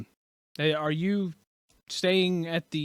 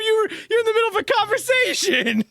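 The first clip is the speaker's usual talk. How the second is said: exasperated shout; 3 dB louder than usual. Real, not acted. Exasperated correction of player.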